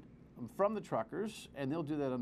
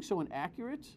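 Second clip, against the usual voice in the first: ordinary talk, nothing marked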